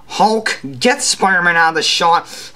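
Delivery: normal talking voice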